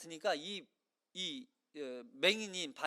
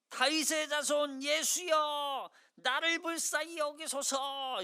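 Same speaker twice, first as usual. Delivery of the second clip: higher, slow, desperate loud cry; 7 semitones higher, slower than usual. Acted. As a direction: cry out for mercy with all your voice. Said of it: "소리를 질러 큰 소리로". (큰 소리로 간절하게 부르짖기)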